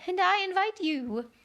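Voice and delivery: high, feeble queenly reply. A narrator's reading. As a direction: weakly return the invitation. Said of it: "smiled feebly and said".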